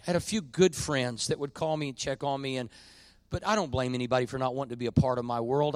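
ordinary talk

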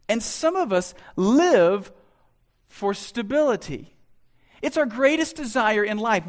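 preaching. Acted. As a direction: preach it with growing force. Preaching with rising force.

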